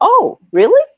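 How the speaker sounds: jumps way up high in mock amazement; surprised reaction. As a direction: imitate the women's surprised gasp. Women surprised by business idea.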